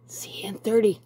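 whispered sign-off. Real, not acted. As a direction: whisper it to the camera. Signing off to oven wait.

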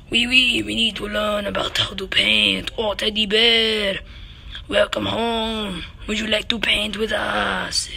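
lightly put-on French accent, slow and fond; a hint of a French painter welcoming a guest. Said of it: "in a French art comic book".

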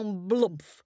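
usual speaking voice

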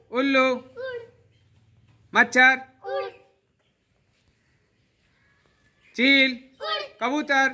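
loud, high game calls to the class; calling out. Real, not acted. Teacher calls out game words.

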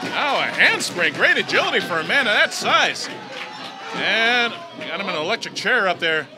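ordinary delivery

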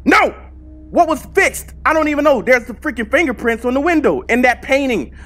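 loud, high-pitched protest; exasperated outcry. Real, not acted. Gamer protests not knowing what was fixed, exasperated outcry. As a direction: cry out in real frustrated disbelief. Upset that the wrong thing fixed.

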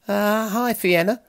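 mild surprise at seeing her; slightly surprised. A narrator's reading. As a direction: caught off guard, a bit high. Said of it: "in a slightly surprised voice".

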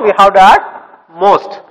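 ordinary delivery

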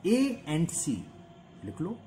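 very slow dictation with pauses. Shown as a demonstration. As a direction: say each word slowly as you write it. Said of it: "from point लिखो".

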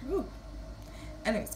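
normal talking voice

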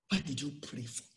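quiet, reflective repeat; softly repeated. Real, not acted. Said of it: "repeats the question softly".

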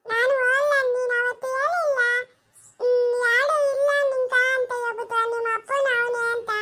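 put-on a small girl refusing, sulking back at her elder; little Janaki refusing to go to her mother's house, defiant; stubborn little girl. Child character answers back.

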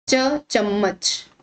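slow, drawn-out च, then चम्मच; pronunciation demo. Shown as a demonstration. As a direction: sound out चम्मच slowly after its first letter. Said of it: "इसका उच्चारण करते समय".

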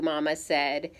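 ordinary delivery

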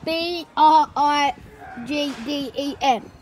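slow letter by letter; spelling slowly. Shown as a demonstration.

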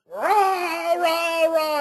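mock high angry shouts of rah; imitated yelling. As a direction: bark out rah rah rah like a screaming kid.

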